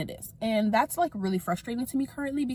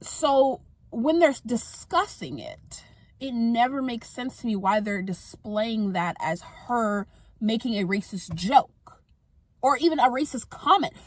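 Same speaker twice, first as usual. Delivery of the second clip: ordinary talk, nothing marked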